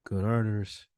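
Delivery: normal talking voice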